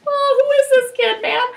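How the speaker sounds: high-pitched, awestruck mock reaction; mock amazement. Acting out amazement at her son.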